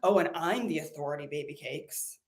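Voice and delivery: lightly voiced sassy guru purring baby cakes; a hint of a smug spiritual guru; teasing impression of herself as a guru; mock sassy guru.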